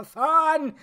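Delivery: loud, high blurted word; playful burst. Pretend. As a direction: burst it out after a joking stutter. Bursts out after stuttering F word.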